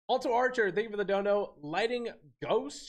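normal talking voice

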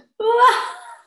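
loud, high whooped laugh tailing off; demonstrated big exercise laugh; big laughter. Shown as a demonstration. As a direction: burst into big unzipped belly laughter. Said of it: "big laughter".

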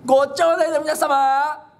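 stretched panic cry of fire. Retold or imitated. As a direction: bellow it out to wake the whole town. Calling fire around the neighborhood.

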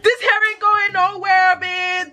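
high, proud bragging; excited. Real, not acted. Bragging about the hair.

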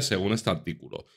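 normal talking voice

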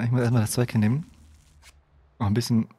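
ordinary talk, nothing marked

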